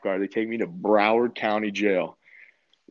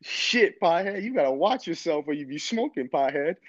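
higher, put-on voice of an amused big cellmate; teasing cellmate; 8 semitones higher than usual. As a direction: rib the new guy like an old-timer.

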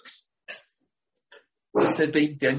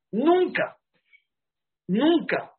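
"Nunca" much higher and louder, falling; emphatic never; 11 semitones higher, 5 dB louder, much slower than usual. Acted. Preacher repeating his warning, loud high "Nunca". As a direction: hammer "Nunca" home.

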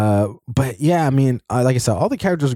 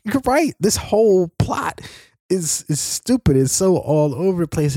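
excited agreement; 8 semitones higher than usual. Retold or imitated. Reacting to the movie.

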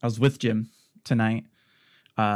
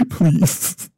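laughing, higher and louder please; 5 semitones higher, 5 dB louder than usual. Real, not acted. Laughing as she begs friends not to tease her. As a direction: giggle through a begging please. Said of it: "please don't make fun of me".

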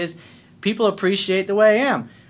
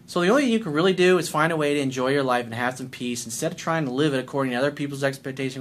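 ordinary talk, nothing marked

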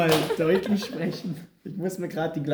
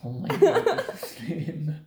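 shocked. Real, not acted. Quiz answer revealed as obvious.

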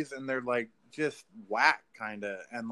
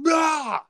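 short high yelp, much louder and higher; retold scream; 9 dB louder than usual. Recalls screaming at the alien, a loud high yelp. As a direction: reenact the scream of fright. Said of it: "I screamed audibly in my room".

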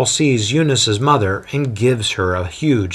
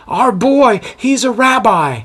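louder and higher for a hard-of-hearing mother; saying loudly; 13 semitones higher, 5 dB louder than usual. A narrator's reading. Daughter speaking up to her hard-of-hearing mother, loud and high. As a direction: speak up so grandma can hear. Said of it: "Eunice says loudly".

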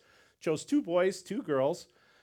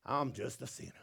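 soft mock Southern accent; 4 semitones lower, 8 dB quieter than usual. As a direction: say it like a Southern churchgoer. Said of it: "if you're from the South".

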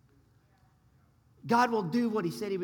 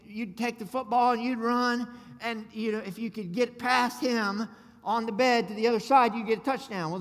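usual speaking voice

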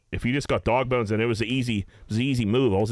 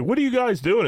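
mock baffled, pitched much higher; puzzled question; 12 semitones higher than usual. Golf partners puzzled by the two friends, high mock bafflement. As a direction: imitate the confused playing partners asking.

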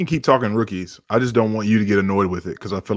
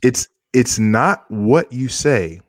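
slow dramatic; 3 semitones higher, 3 dB louder, much slower than usual. Acted.

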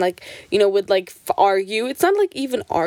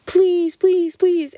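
high, insistent mock pleading; begging child; 11 semitones higher, 3 dB louder, much slower than usual. Sisters begging mom for a Barbie, whiny pleading. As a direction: beg like a little kid, pitched up.

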